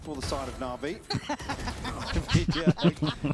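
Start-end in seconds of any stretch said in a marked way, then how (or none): 1.0-3.3 laughing. Real, not acted. Caster laughs at play.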